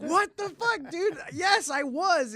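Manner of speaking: high, slow outraged "yes, I was"; outraged protest. Retold or imitated. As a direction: protest to the judge in shocked outrage. Outraged answer to the judge.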